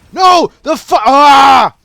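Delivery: high, full-volume yell breaking into a scream; frustrated scream. Real, not acted. Gamer losing the fight, frustrated scream. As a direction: cut the curse off with a long scream. Dies in game.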